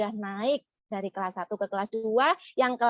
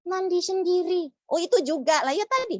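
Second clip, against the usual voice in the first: put-on squeaky child puppet voice, confused; high puppet voice; 8 semitones higher, 3 dB louder than usual. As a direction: play the little puppet mishearing. Puppet voice of Mulia.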